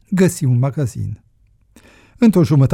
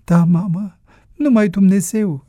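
higher, plaintive motherly voice; higher-voiced mother's echo; mother echoing him; 7 semitones higher than usual. A narrator's reading. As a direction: repeat it in agreement, pitched up. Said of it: "repetă Romanța".

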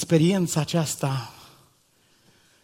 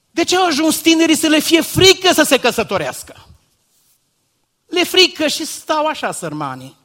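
much higher, louder pleading question; impassioned preaching; 11 semitones higher, 6 dB louder than usual. Acted. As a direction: cry out the question to the congregation. Preaching at full force.

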